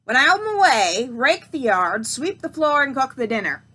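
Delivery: loud, bossy witch orders; witch commanding loudly. Acted. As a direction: bark out the chores. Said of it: "she announced".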